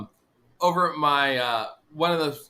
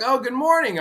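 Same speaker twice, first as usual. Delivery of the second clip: lady's greeting; 10 semitones higher, 4 dB louder than usual. Retold or imitated. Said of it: "And she's like".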